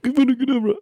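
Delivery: high, sharp little spell cry; squeaky mock wizard casting with a small stick; small wand spell cast. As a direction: fire off a tiny curse with a twig. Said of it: "pick up something small and they're like".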